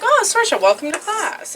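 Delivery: high, welcoming teacher voice. Retold or imitated. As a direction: greet her like a fond teacher. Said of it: "they're like".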